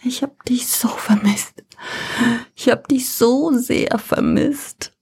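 high, hushed whisper. Acted. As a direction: whisper it through tears. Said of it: "Sie flüstert".

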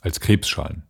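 usual speaking voice

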